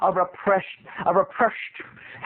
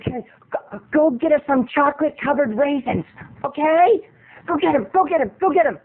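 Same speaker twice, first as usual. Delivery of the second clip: higher, pushy mom voice; 6 semitones higher than usual. Retold or imitated. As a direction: urge her daughter to fetch snacks. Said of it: "She's like".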